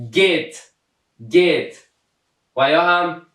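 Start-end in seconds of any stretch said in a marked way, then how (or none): 0.1-1.8 "گیت" begun with a hard G; careful teacher demo of "گیت", hard G first. Shown as a demonstration. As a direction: model "گیت" with G as in go. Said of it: "صدای گاف افاده کرده".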